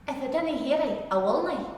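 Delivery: Ulster Scots dinna and nae, run together; Scottish sound. Shown as a demonstration. Teacher demonstrating a Scots phrase to the class, Ulster Scots run together. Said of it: "This is a Scottish sound".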